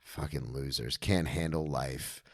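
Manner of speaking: low, very soft scornful aside; muttered contempt. Retold or imitated. Newly sober man driving by the liquor store, muttered contempt. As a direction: mutter it slowly, imitating private disdain.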